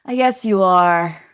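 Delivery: retold reluctant agreement; grudging concession. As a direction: concede it reluctantly to your child.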